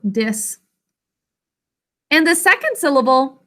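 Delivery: ordinary delivery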